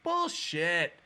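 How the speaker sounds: high, loud crowd heckle; mock incredulous yell; disbelieving shout. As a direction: imitate a crowd demanding proof.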